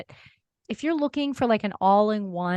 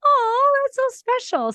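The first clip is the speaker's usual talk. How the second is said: excited; 14 semitones higher, 4 dB louder, slower than usual. Retold or imitated. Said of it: "I was like".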